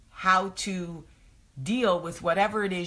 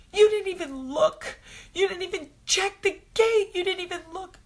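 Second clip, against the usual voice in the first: much higher, louder accusing wail; imitating angry distraught child; 5 dB louder than usual. Daughter blaming mom for not checking the gate, high accusing wail. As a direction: throw the blame at mom in a high tearful voice. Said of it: "angry and distraught".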